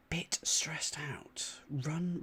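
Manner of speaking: soft unvoiced murmur while thinking; whispering to himself. Real, not acted. Solver mulling the clue aloud, hushed whisper. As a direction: breathe the words out, puzzling.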